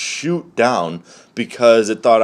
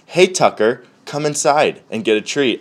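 raised, higher coaxing call; calling the dog; 5 semitones higher, 3 dB louder than usual. Acted. Owner tempting Tucker the dog inside, raised calling voice.

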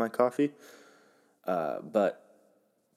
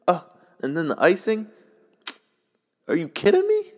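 excited; 11 semitones higher, 7 dB louder than usual. Real, not acted. Raving about Cinnabon icing.

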